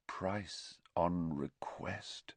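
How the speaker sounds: drawn-out, quiet echo of the words; slow, hushed echo. A narrator's reading. Narrator echoes the advert's price line, slow and hushed.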